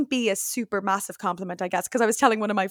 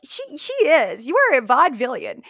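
emphatic agreement; 4 semitones higher, 6 dB louder than usual. Retold or imitated. Choreographer chimes in emphatically.

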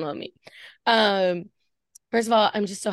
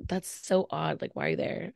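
quiet; 2 semitones lower, 8 dB quieter than usual. Retold or imitated. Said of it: "I'm just like".

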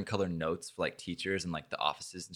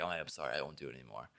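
much softer, lower mock-sheepish apology; meek apology; 6 semitones lower, 13 dB quieter, faster than usual. Retelling his apology text to the bully, meek and soft. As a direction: say it small and sheepish. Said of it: "I was like".

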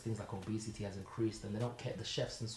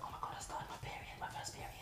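whisper; 10 dB quieter than usual. Retold or imitated. Friend confiding her first period.

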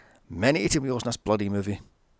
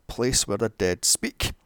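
ordinary talk

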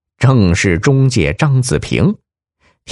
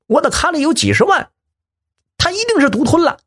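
high, fast, yelling in a thin voice; shrill cry to turn the bandits on each other; mock yell; faster than usual. Hostage shouting to fool the bandits, high and fast. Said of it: "他大喊起来".

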